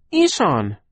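put-on high piercing baby shriek. A narrator's reading. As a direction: shriek it out sharply. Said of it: "Sunny shrieked".

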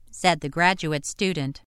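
ordinary talk

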